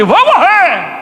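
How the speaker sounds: high, frightened cry of drowning; mock terror of a man in a storm; panicked cry. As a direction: cry out as if the boat is sinking. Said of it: "você fala assim".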